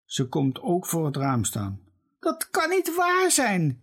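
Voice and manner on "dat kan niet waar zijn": very high, wailing lament. A narrator's reading. Mother at the window wails over the garden. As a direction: wail it in disbelief. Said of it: "jammert ze".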